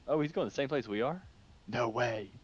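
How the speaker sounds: real delight at a surprise; excited. Real, not acted. Spots a plane going his way.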